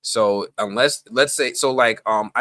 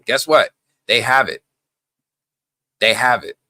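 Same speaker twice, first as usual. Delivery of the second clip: louder, punchy reveal; emphatic; 3 semitones higher, 7 dB louder than usual. Real, not acted. Telling worried viewers companies already have it, loud emphasis. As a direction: land it loud and firm.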